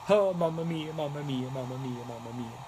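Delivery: fading, softening mock Italian mamma mia. Crafter repeating her catchphrase, light Italian fading out. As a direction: let each mamma mia trail off Italian style. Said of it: "That's the one".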